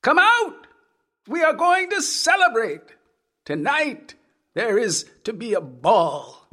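put-on eager old man. A narrator's reading. Old man inviting hidden girls, high eager call. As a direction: coax them out with creepy glee.

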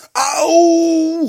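long, very high, loud "ow" scream; pained shriek. A narrator's reading. Ka pinched in the calf while sleeping, pained shriek. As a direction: yelp out in sudden pain, full voice. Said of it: "gilde Ka".

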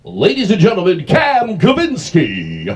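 big drawn-out showbiz introduction; stage announcer. Pretend. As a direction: stretch the name out like an emcee.

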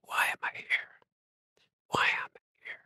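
whispered, lost and to himself; mumbling to myself. Retold or imitated. Alone in the garden, whispering a question to himself. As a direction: breathe the words out without voice. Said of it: "I was mumbling to myself".

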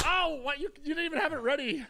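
high, fast surprised protest. Real, not acted. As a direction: yelp it out in surprise. Surprised co-host started drinking.

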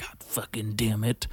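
slow, unvoiced grumble; whispered frustrated curse. Frustrated at a rival's success, whispered. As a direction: hiss it under your breath.

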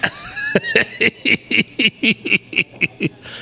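long, high-pitched giggling; high giggle. Real, not acted. Comic laughing at his own pizza confession, high giggling. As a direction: burst into a long squeal of laughter.

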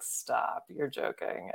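breathless whispered disbelief; hearing the book hit number one, whispered disbelief; stunned whisper. Retold or imitated. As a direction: whisper it as if you can't believe it. Retold disbelief on phone call.

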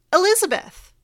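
high, bright announcement of a name; grand introduction. Real, not acted. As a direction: present the guest with a flourish. Host announcing her guest.

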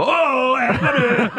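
high, shouted Åh Alberne; yelling catchphrase. Retold or imitated. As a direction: bellow it like a man lost on a ski trip. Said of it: "går igennem billeder og råber".

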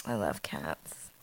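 very slow, hushed and low; barely-there, drawn-out murmur; hushed confession. Pretend. Cat lover confessing to her co-host, hushed. Mock-tearful confession of loving cats.

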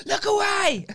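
high, loud comic wail; Bridesmaids quote shout. Acted. Quoting a film's toilet scene, high shout. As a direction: shout it in mock desperation. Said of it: "quote Bridesmaids".

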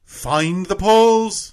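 very slow, high, loud British voice; drawn-out, swelling British accent; British announcer impression. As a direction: stretch it out grandly like a British announcer. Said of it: "go back and hit find the polls".

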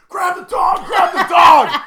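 high, loud frantic yell; panicked shout. Retold or imitated. Owner yelling at the delivery girl to grab the escaping dogs. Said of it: "I'm like".

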